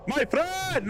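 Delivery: very high cheery call of my friend; high sing-song hello to the enemy player; excited greeting. Acted. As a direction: call out like spotting an old pal. Calling out to enemy happily.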